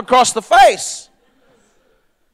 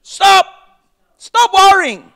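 commanding stop; 8 semitones higher, slower than usual. Retold or imitated. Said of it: "He said".

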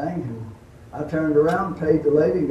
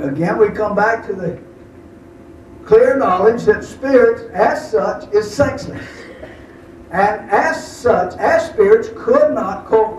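louder, higher preaching voice; 5 semitones higher, 4 dB louder than usual. Acted. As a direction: preach it to the congregation with force. Preaching scripture.

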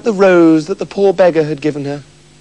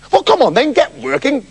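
bossy cook; 8 semitones higher than usual. Acted. Cook orders Beryl.

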